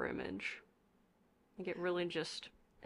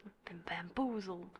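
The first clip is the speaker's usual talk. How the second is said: whispered; 5 dB quieter than usual. Pretend. Echoing host's line in a whisper.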